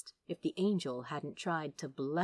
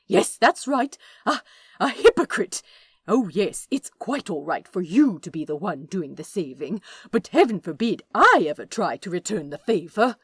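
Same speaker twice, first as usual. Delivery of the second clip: sarcastic; 9 dB louder than usual. Acted. Aziraphale mocks Crowley.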